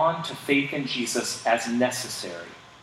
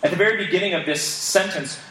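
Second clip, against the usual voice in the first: ordinary delivery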